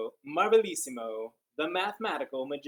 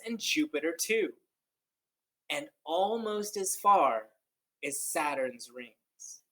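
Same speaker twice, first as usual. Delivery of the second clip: read-aloud storytelling; 3 semitones higher, 6 dB louder than usual. Acted. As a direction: read it like a wondrous tale to kids. Reads picture book aloud.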